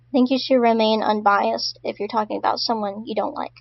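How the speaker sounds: normal talking voice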